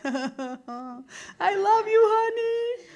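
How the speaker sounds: slow, sugary "I love you, honey", rising high on "honey"; forced sweetness. Retold or imitated. Mother nervously reassuring her scary daughter, slow sugary voice. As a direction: stretch it out sweetly to hide the nerves.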